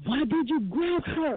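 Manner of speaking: high accusing child voice, imitating the son; child's angry demand. Son accusing mother in a high, upset imitated voice. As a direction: throw the question at her like an upset little boy.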